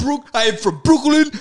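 loud, high put-on accented voice; Bolo Young. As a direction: proclaim it in a mock foreign accent. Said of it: "yeah, Bolo Young".

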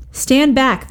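a sharp high shout of a mob; shouting crowd. A narrator's reading. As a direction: throw them back with a yell. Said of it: "they shouted".

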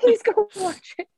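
very high, squeaky plea; high pleading. Real, not acted. Guest begging listeners to watch Bridgerton, high pleading.

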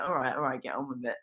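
low-key, dismissive muttered agreement; cynical. Retold or imitated. Sceptic going along with the shaman's ritual, mildly cynical. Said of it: "I was very cynical at that point".

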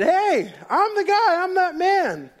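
high, loud, eager protest; hammed-up blind man insisting it's really him; insisting excitedly. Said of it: "But he himself insisted".

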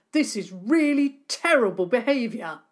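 indignant. Acted. Angry neighbour scolding.

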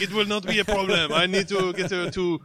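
put-on loud, high French accent panic; hammed-up French chef, flustered. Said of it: "you're probably like".

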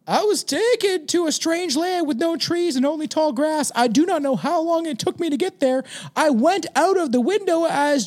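put-on high, earnest boy's voice reading testimony; sixteen-year-old kid. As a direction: read it out as a teenage boy. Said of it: "He's a fucking sixteen-year-old kid".

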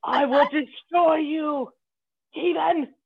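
hammed-up menace, dragged out and proud; mock warrior threat.

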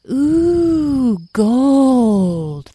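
high, loud, drawn-out wonder; awed fairy chorus. Acted. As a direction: swoon together over treasure. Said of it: "they all seemed to say at once".

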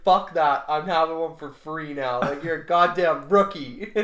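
loud, mock-tough comeback; defiant retort. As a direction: put the rookie in his place, loudly. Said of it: "I was like".

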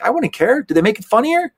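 high, emphatic, incredulous; mock exasperated. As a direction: toss it off as if it's obvious. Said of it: "I'm like".

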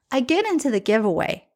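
ordinary delivery

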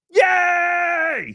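very high shouted yay; hammed-up celebratory whoop; mock cheer. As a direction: squeal it long and loud. Cheers own announcement.

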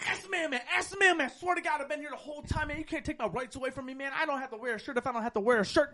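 high, rapid-fire ranting; frantic pleading. Retold or imitated. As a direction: rattle off a panicked protest, imitating him. Retold man pleads with the police.

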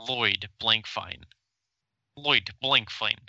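Lloyd Blankfein spelled out slowly, Blankfein with a fine ending; slow careful name. Shown as a demonstration. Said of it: "My name is Lloyd".

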